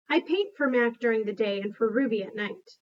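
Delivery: usual speaking voice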